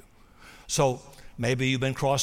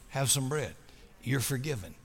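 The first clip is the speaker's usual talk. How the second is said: tender; 3 semitones lower, 7 dB quieter than usual. Acted. Jesus voiced offering forgiveness.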